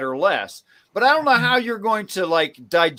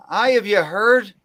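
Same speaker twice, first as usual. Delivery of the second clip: mock Irish accent. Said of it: "that is clearly an Irish accent".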